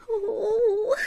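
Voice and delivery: a quick high teasing ooh with a giggle; playful ooh. Acted. Reader to listening children, playful ooh. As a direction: coo at the children.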